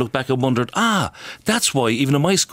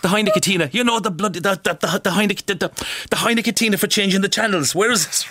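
high, fussed, impatient father; lightly voiced grumpy father searching; exasperated old dad; 7 semitones higher than usual.

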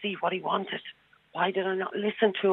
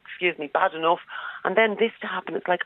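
ordinary talk

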